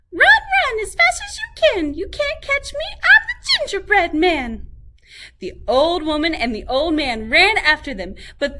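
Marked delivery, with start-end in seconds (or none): 0.1-4.7 very high, loud taunting singsong; cheeky gingerbread man. A narrator's reading. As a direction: tease them as you dash away. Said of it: "So he ran singing".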